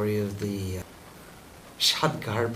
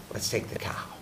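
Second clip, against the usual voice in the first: whispered, softer scheming; conspiratorial whisper; 5 semitones lower, 8 dB quieter, faster than usual. Acted. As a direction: hush it like a plot among thieves. Brother plotting the theft.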